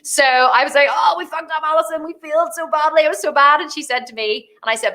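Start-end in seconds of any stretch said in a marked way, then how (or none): 0.9-3.6 high-pitched retold wail of dismay; dramatic lament. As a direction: overdo the self-blame. Retelling her lament to copywriter.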